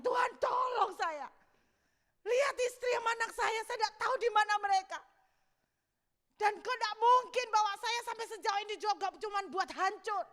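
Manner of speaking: high, loud desperate outcry; shouted plea. Acted. David pleading for his family, shouting. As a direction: shout up at God in anguish. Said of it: "Setelah dia teriak-teriak".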